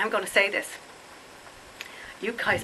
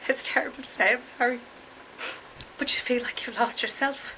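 getting emotional; 7 semitones higher, 4 dB quieter than usual. Real, not acted. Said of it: "it is an emotional topic".

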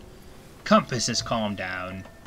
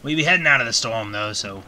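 lightly voiced pirate captain, a hint of gruff. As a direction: play a sea captain steering through a storm. Pirate talk in Sea of Thieves.

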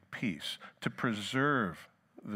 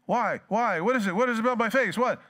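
much higher, louder rapid panic; panicky alarm; 11 semitones higher, 6 dB louder than usual. Acted. Touchy person panicking at the asker's remark, loud and high. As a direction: fret anxiously about your face.

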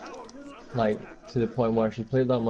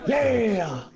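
high excited yell of victory; triumphant shout; 10 semitones higher than usual. Real, not acted. Kills enemy, celebrates.

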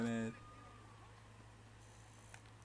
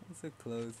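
ordinary delivery

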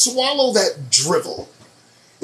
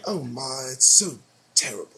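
mock pity; 6 semitones lower, 4 dB quieter than usual. Mocking fake sympathy.

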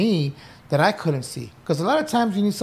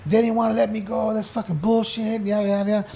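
higher, aggrieved griping; victim complaining; 6 semitones higher, faster than usual. Retold or imitated. Inmate complaining to his peers, aggrieved. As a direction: whine that they did you wrong. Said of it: "sharing my victim story".

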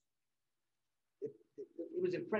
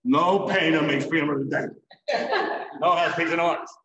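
scoffing; 11 dB louder than usual. Retold or imitated. Scoffing as young painters.

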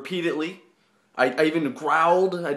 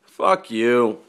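ordinary delivery

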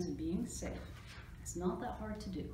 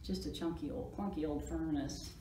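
usual speaking voice

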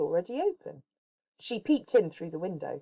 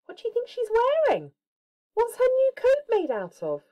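much higher, fast, eager; excited question; 4 dB louder than usual. A narrator's reading. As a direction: ask the kids with bright excitement. Asking child listeners.

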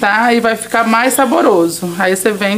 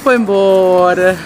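usual speaking voice

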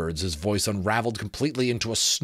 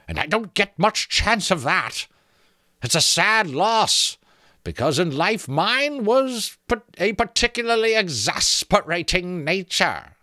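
creaking skull voice; 11 semitones higher, 5 dB louder than usual. A narrator's reading. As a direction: creak out the offer like a teasing sentry. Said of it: "sounded like branches creaking".

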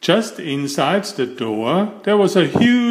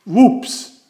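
high, louder comic whoops; 7 semitones higher, 4 dB louder than usual. A narrator's reading. Mister Clumsy landing in the duck pond, comic whoops. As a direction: chirp it out in the splash.

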